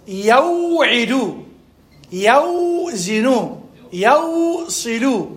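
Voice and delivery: drawn out, first syllable stretched high; original forms. Shown as a demonstration. Teacher showing students the original forms, drawn-out demonstration. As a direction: hold the "aw" long in each word. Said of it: "الأصل".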